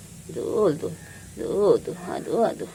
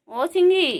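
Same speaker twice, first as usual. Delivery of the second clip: calling out; 10 semitones higher, 5 dB louder than usual. Real, not acted. Calling the pet.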